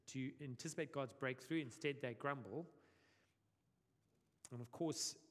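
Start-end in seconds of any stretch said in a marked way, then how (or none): none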